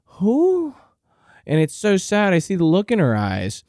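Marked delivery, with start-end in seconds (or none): 0.0-0.7 confused grandma. Retold or imitated. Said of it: "She's like".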